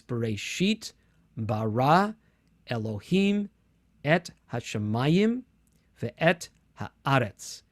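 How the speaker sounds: Bereshit, bara, Elohim stressed on their last syllables; late-syllable stress. Shown as a demonstration. Teacher showing Hebrew stress marks, Bereshit stressed on SHIT, measured. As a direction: hashamayim and haaretz stressed second to last, word by word. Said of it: "stressed on the last syllable or the second".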